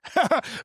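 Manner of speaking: short high-pitched laugh; amused laugh. Real, not acted. Recalling mother's expression, amused laugh.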